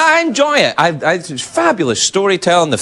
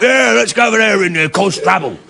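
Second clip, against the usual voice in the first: rough Cockney monster voice; mock Cockney movie-orc accent. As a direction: talk like a Cockney orc brute. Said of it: "they all talk like Cockneys".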